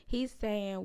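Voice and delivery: usual speaking voice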